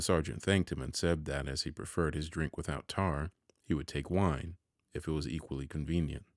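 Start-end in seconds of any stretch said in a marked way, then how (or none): none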